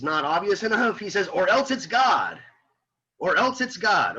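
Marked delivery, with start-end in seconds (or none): 1.3-4.2 higher, slow, emphatic repeat; emphatic quote. Retold or imitated. Teacher quotes the text to spell out the answer, emphatically. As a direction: hammer it home, landing on "God".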